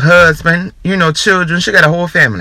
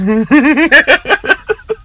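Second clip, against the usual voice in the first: giggle; 10 semitones higher than usual. Real, not acted. Laughing again at the song.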